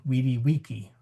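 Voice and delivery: "vidi, vici" with W for V, wee-dee wee-kee; classical Latin W sounds. Shown as a demonstration. Teacher demonstrating classical Latin, "vidi, vici" with W sounds. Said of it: "veni, vidi, vici as weni".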